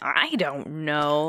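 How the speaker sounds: lightly mocking imitation of a sassy host; a touch of exaggerated sass; sassy radio host. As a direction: mimic a host's sassy brush-off. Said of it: "Art Bell was much sassier".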